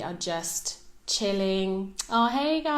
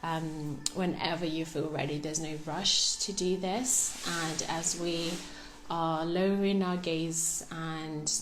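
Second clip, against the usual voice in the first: guided meditation; 6 dB quieter than usual. Real, not acted. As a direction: guide them gently through the breathing, softly and unhurried. Leads a breathing meditation.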